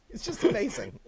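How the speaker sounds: amused; laughing. Real, not acted. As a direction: still chuckling on the repeat.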